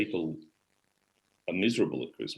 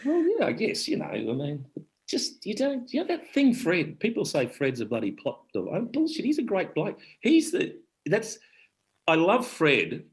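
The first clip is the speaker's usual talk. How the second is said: excited; 11 semitones higher than usual. Real, not acted. Excited defence of Fred.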